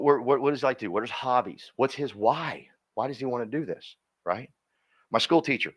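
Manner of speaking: usual speaking voice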